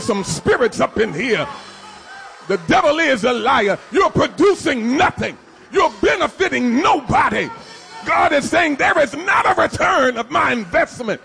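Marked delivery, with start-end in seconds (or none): none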